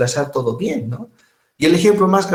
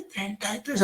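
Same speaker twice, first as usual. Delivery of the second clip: much higher, much softer, feeble voice; frail old man; 7 semitones higher, 10 dB quieter than usual. Retold or imitated. Teacher imitating an elderly patient who can't shout, feebly. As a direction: barely get the words out like a frail old man. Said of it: "No pueden gritar. Entonces, suavite".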